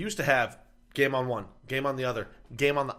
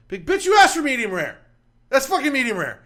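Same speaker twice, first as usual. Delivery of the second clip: loud, higher exasperated rant; mock rant; 9 semitones higher, 5 dB louder than usual. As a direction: tell off the customer with full indignation. Ranting at the customers.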